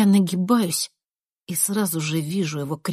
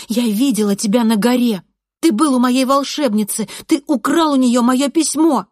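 loud, raised, pressing; angry accusation; 6 semitones higher, 7 dB louder than usual. A narrator's reading. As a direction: accuse him forcefully, point by point. Narrator accusing boy during fight. (гневно обвиняет)

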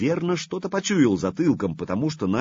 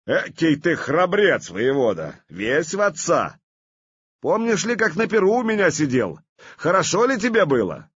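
mocking jeer; 7 semitones higher, 3 dB louder than usual. Vladimir mocks the commander.

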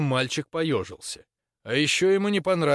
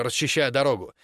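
ordinary delivery

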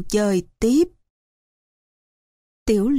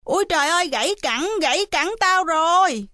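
faked pained cry; 7 semitones higher, faster than usual. A narrator's reading. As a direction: pretend to cry out in pain. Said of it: "ôm giò hét ầm lên". (giả vờ la đau, giọng cao)

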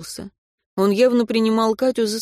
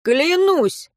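smirking; 9 semitones higher than usual. A narrator's reading. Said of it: "ухмыльнулся Антон".

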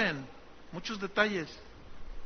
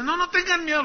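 reassuring Joseph; 10 semitones higher, 4 dB louder than usual. Acted. Joseph calming frightened brothers.

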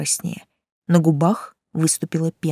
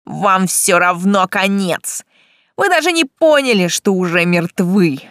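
maniacal shout; 4 dB louder than usual. A narrator's reading. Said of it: "безумно расхохотался и крикнул".

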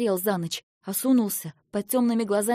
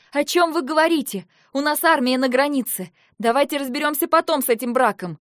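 higher, louder frustrated plea; exasperated outcry; 5 semitones higher, 6 dB louder than usual. Acted. Heroine exasperated with two arguing men, raised. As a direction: cut through their quarrel.

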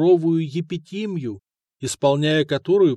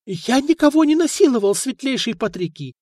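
high, louder whimpering protest; whining plea; 10 semitones higher, 3 dB louder than usual. A narrator's reading. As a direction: whine out your innocence. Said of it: "заскулил ростовщик".

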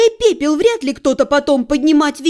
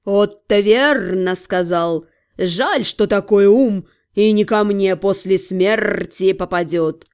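slow, low, bored drawl; lazy bored demon cat, very slow; snooty bored cat; 6 semitones lower, slower than usual. A narrator's reading.

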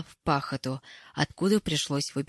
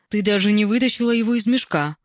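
higher, louder reproach; reproachful; 5 semitones higher, 5 dB louder, faster than usual. A narrator's reading. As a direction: scold a friend for her carelessness. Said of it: "укоризненно произнесла Ирка". (с укоризной)

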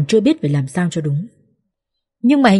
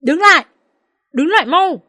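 mother-in-law screams after the departing car; screaming; 10 semitones higher than usual. A narrator's reading. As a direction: yell it high and hard. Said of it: "gào lên".

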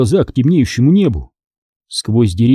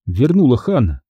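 usual speaking voice

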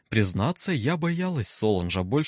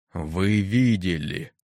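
slow, booming, impassive question; menacing deep voice; 4 semitones lower, 3 dB louder, much slower than usual. Acted. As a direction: let the words fall into silence. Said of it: "глубокий, низкий, бесстрастный".

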